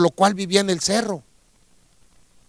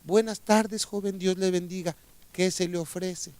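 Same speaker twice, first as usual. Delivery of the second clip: put-on soft, gentle old woman; sweet voice; 9 dB quieter than usual. Kind old woman greeting the hermit in a gentle voice. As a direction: say it tenderly and quietly. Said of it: "la dulce voz de la viejita".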